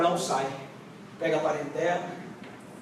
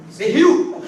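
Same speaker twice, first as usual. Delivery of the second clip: laughing question; 5 semitones higher, 9 dB louder, slower than usual. Acted. Laughing mock of Sara.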